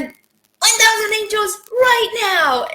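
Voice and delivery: high, drawn-out call to the angels; reenacted scream. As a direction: cry it out slowly at a very high pitch, a little held back. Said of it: "she just screamed out like that".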